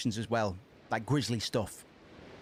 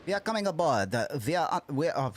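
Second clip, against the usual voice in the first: put-on European accent; 2 semitones higher than usual. Streamer voicing boarding crew, mock European accent. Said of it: "miscellaneous European accents for these guys".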